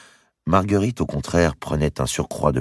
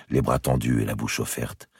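usual speaking voice

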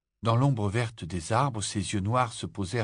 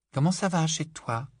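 lightly voiced young Lily, curious; a girl asking gently, a bit higher; young girl's voice; 7 semitones higher than usual. A narrator's reading. Child Lily voiced in story. (petite fille curieuse, voix plus aiguë)

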